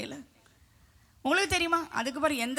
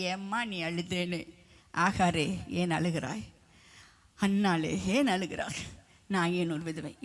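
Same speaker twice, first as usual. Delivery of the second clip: tender questioning; 4 semitones lower than usual. Acted. God asking weeping women why they cry, tenderly. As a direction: gently console each one by name.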